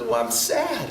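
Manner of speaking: high-pitched little-boy whine, imitated; mock tearful kid; sad crying child. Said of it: "And so he's crying".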